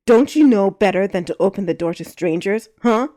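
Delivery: loud angry scolding; scolding the kid for opening the door, loud; barking. A narrator's reading. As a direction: bark it right in her face. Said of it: "he barked".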